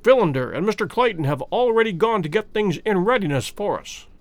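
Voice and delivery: ordinary delivery, nothing marked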